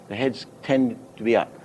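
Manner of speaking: usual speaking voice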